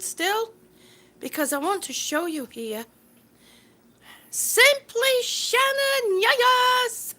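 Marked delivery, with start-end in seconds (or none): 4.3-7.1 high, loud, slow cheer of a name; excited welcome. Real, not acted. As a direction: cheer as if a friend walks in. Greeting a viewer in live chat.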